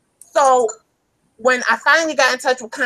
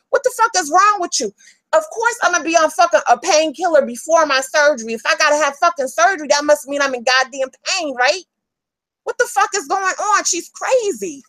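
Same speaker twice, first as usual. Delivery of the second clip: higher-pitched, exasperated outburst; worked up; 4 semitones higher than usual. Real, not acted. Defending painkiller use before surgery, worked up and indignant. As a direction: let real indignation push the voice up. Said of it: "I gotta take a breath".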